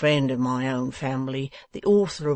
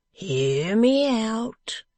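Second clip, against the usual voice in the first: higher, slow and pompous; grand stalling; 6 semitones higher, slower than usual. A narrator's reading. Wegg teasing Venus with the find, slow and high. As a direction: puff it up, take your time.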